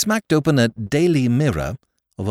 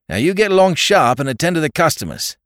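higher, louder brisk command; sharp order; 8 semitones higher, 5 dB louder than usual. A narrator's reading. Landlord sends the potman back to work, sharp and loud. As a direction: send him off sharply.

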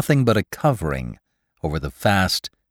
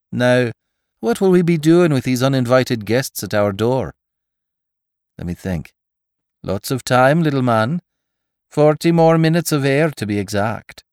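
lightly voiced breezy Irish tough guy; a hint of cocky Irish banter; jaunty Irishman; 4 semitones higher than usual. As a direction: banter breezily in danger, pitched up. Irishman banters with his companion in danger.

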